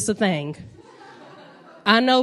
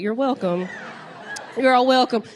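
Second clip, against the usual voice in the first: usual speaking voice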